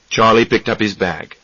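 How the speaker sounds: plain reading voice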